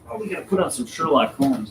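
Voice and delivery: usual speaking voice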